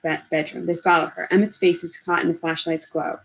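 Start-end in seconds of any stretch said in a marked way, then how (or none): none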